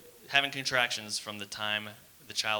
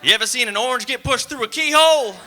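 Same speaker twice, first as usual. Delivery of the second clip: high, very loud doom holler; over-the-top shouted warning; horror-movie warning guy; 13 semitones higher, 13 dB louder than usual. Acted. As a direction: holler the warning like a crazed local. Warning guy warns about childbirth.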